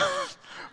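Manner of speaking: short high giggle; a quick excited laugh; high burst of laughter. Real, not acted. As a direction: laugh at the obvious answer. Excited about pizza invite.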